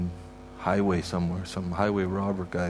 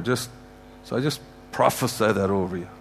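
ordinary delivery

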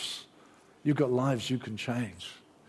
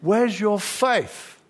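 slow, loud, higher rebuke; Jesus rebuking the scared disciples in the boat, slow and loud; 6 semitones higher, 7 dB louder, much slower than usual. Retold or imitated. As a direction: call it out slowly across the storm. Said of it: "Jesus says".

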